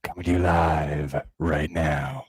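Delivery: low, loud, slow radio DJ voice; mock smooth-radio patter, deep and close; smooth radio host. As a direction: croon it right on the mic like a radio announcer. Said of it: "guys who work in radio".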